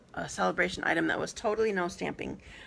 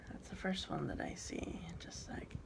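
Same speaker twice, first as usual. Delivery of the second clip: whispered to herself while reading; whispered aside; 3 semitones lower, 15 dB quieter than usual. Real, not acted. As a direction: breathe it under your breath. Muttered aside while reading comments.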